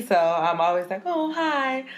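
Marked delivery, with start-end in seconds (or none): 1.1-1.8 put-on high cooing baby talk. Aunt cooing at her nephew on the phone, baby voice. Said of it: "So I'm in the baby voice".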